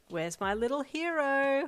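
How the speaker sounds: loud, high, slow cheerful call. A narrator's reading. Father calls to his son as he opens the door, loud. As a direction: sing it out through the door. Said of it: "Hawks called out".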